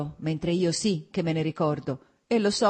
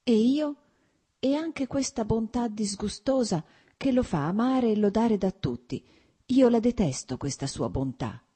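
higher-pitched indignant outburst, falling away at the end; bitter indignation; 7 semitones higher than usual. A narrator's reading. Wife thinking of her husband's disgusting goodness, bitterly indignant.

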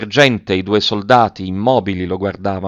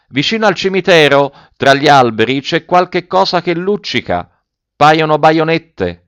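calling down; 7 semitones higher, 3 dB louder than usual. A narrator's reading. Boy lookout reporting to officer, calling out. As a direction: sustain each phrase to carry far.

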